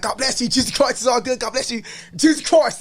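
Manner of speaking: high, loud, beaming blessing; cheerful blessing. Retold or imitated.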